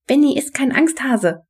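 high-pitched objection; protesting. A narrator's reading. Henrietta defends Benny against his uncle, protesting. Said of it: "protestierte Henrietta".

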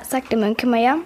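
usual speaking voice

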